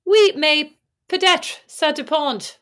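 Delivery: exaggerated Irish-accented French. Shown as a demonstration. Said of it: "speak French in an Irish accent".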